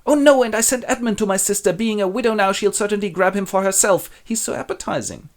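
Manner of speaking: high, petulant wail of dismay; jealous Goneril. Acted. Goneril frets about her sister and Edmund, high and petulant. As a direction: whine about losing her lover.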